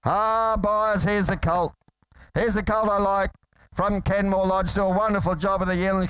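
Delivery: ordinary talk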